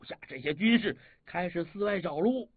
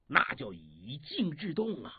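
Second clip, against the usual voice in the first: ordinary delivery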